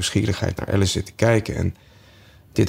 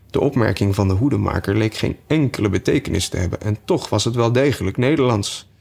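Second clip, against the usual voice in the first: ordinary delivery, nothing marked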